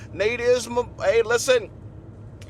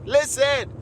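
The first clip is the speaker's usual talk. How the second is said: ordinary delivery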